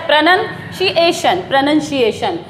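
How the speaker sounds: "प्रोनाउंसिएशन" as pruh-nun-SHEE-AY-shun; stress on shee. Shown as a demonstration. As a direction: model "प्रोनाउंसिएशन" with nun, not noun. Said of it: "शी पर जो है यहाँ stress".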